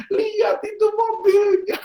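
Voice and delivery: slow, very high imitated wail; crying child. Son shows papa the scratched car, high mock-crying wail. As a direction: wail it like a guilty child, drawn out. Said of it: "Kenapa kamu menangis?".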